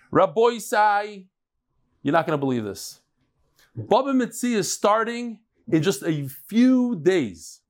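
emphatic announcer pitch with drawn-out key words; promo to camera. Acted.

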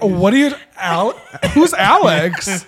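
mock clueless. Imagining their friend's reply if Alex were missing, laughing and mock clueless. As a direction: play dumb while cracking up. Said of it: "He'd be like".

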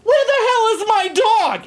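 high-pitched exasperated shouting; mock frantic yell. Man who lost his dog Luna, frantic yell. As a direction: imitate an owner yelling for a missing dog.

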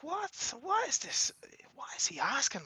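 high "what?" dropping into a whisper; hushed, retold bewilderment; baffled aside. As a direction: whisper it in puzzled disbelief. Retelling his bafflement at the request.

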